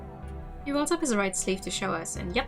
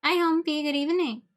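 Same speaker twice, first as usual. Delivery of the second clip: high, louder chirpy hi; cheery greeting; 7 semitones higher, 4 dB louder than usual. Real, not acted. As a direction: greet brightly and playfully.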